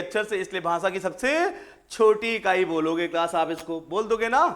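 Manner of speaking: normal talking voice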